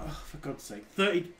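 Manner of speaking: ordinary talk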